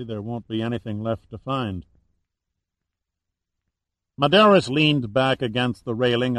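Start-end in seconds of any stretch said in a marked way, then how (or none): 0.0-1.8 softer, pessimistic aside; pessimistic mutter. A narrator's reading. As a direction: mutter it gloomily, voice dropping away. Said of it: "muttered pessimistically".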